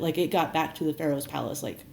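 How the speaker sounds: usual speaking voice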